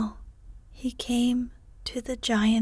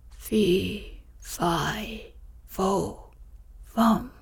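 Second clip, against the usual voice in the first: a hint of a giant's bellow inside the whisper; whispered giant bawl; 2 semitones lower, much slower than usual. Giant stamping in and bawling at his wife, held to a whisper. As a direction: growl the chant as a giant, still hushed. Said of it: "he bawled".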